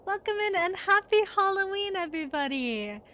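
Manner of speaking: high, bubbly anime greeting; cute anime streamer. Acted. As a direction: squeal hello to the chat. Streamer greets chat, persona intro.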